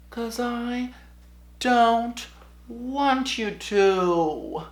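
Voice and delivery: high, soft, drawn-out little voice; slowly eke out a secret reply; thinking it in a tiny high voice; unspoken inner thought. Retold or imitated. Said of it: "what I was thinking in my head, but I couldn't say it".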